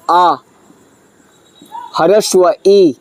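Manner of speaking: normal talking voice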